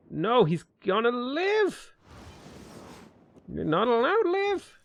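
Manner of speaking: high, drawn-out wail of protest; playful anguished complaint; mock protest. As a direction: whine it high, stretching the last word. Mock outrage at surviving enemy.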